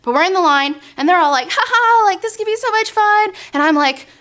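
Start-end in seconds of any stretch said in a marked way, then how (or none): 1.5-3.3 higher, laughing excitement; mock the friends' giddy anticipation; excited friends. Friends in the ride line, giddy and excited.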